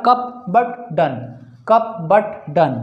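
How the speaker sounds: "कप but डन" slowly, word by word, each with uh; short uh sound. Shown as a demonstration. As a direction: space out "कप but डन" to show the vowel. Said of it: "अ का sound आ रहा है".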